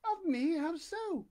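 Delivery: high, startled question; puzzled and taken aback; surprised lady. Acted. Forestier voiced, surprised.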